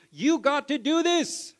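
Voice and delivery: high, loud, slow push; James urging. Retold or imitated. James pushing the listener to act, loud and slow. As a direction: drive it home like a shove. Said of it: "James is smacking you going".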